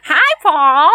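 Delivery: attempted Texan accent. Pretend Texan caller greets Paul, mock accent. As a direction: try a Texas lady greeting Paul. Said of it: "I can't do the accent".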